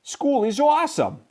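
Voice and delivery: put-on high, eager kid shout; excited shout. A narrator's reading. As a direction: shout it with joy. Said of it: "shouted Clark the Shark".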